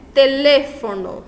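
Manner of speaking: "Teléfono" slow and loud, stressed on LÉ. Shown as a demonstration. Teacher showing an esdrújula, "Teléfono" stressed on LÉ. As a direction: stretch and punch the LÉ in "Teléfono". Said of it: "a sílaba tônica recai no le".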